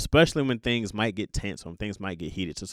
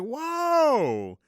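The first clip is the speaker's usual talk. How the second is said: shocked whoa; 14 semitones higher than usual. Retold or imitated. Overhearing a woman cuss out her husband, stunned. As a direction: react like you can't believe what you just heard. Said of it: "I'm like".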